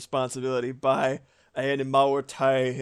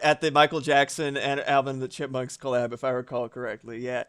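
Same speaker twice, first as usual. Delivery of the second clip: ordinary delivery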